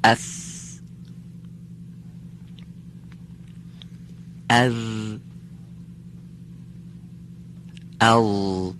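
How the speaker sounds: اث with the tongue tip at the upper teeth; اث اذ ال, tongue against the teeth; letter drill. Shown as a demonstration. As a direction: say اث, اذ and ال slowly, one at a time. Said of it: "من یک بار تلفظ کنم".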